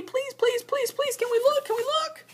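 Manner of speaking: put-on very high, begging child voice; high pleading kids. A narrator's reading. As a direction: plead like an eager little cub. Cubs begging to look.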